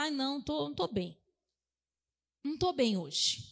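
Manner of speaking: a sagging, defeated sigh of an excuse; mock downcast.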